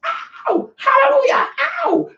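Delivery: very high-pitched mock exorcist shrieks; mock exorcism. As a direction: shriek hallelujah like a hammed-up exorcist. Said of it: "y'all witnessing a live exorcism".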